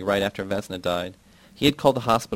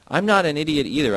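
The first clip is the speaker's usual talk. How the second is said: louder and much higher, indignant; angry shout; 6 semitones higher, 5 dB louder than usual. A narrator's reading. Yarostan shouting at Yasna, angry and loud. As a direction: hurl it back at her. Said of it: "I shout to Yasna".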